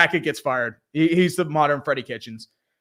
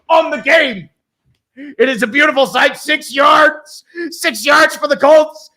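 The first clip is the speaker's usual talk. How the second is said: mock-epic play-by-play; 5 semitones higher, 7 dB louder than usual. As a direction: narrate a five-yard run like a historic moment. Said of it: "I have to record this for posterity's sake".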